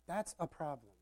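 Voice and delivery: ordinary talk, nothing marked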